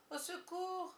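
the call fading away; faint cry for help. A narrator's reading. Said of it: "un faible cri s'éleva". (second appel plus aigu et faible)

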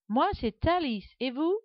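put-on high, slowish little girl voice; young girl. A narrator's reading. Alice introducing herself, high girlish voice. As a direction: introduce yourself like a polite girl.

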